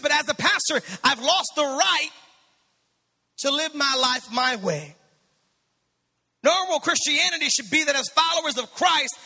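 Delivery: impassioned sermon delivery; preaching. Acted. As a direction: drive it home from the pulpit. Building sermon passage.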